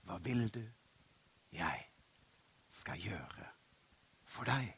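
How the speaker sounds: hushed, low, near-whispered asking; gentle question. Acted. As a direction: ask softly, pausing between phrases. Jesus gently asks the blind man.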